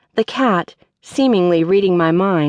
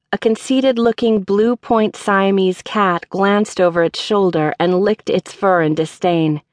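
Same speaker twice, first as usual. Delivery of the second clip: ordinary delivery, nothing marked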